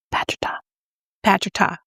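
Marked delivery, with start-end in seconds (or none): 0.1-0.6 softly whispered brand name; mock-secret hush. Vlogger secretly naming the non-Tati brush, hushed.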